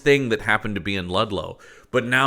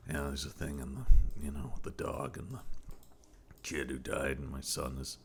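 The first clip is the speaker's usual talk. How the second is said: flat, fading, barely-there line reading; low-energy mumble; 9 semitones lower, 9 dB quieter than usual. Retold or imitated. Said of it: "every line delivery is just like".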